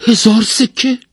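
high, loud, rising question; merchant's voice shooting up in surprise; astonished. A narrator's reading. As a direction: echo the price in sheer amazement. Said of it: "مرد بازرگان با تعجب پرسید".

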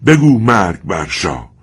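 loud, slow, angry urging; voice shaking with anger. Acted. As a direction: urge the boy, trembling with rage.

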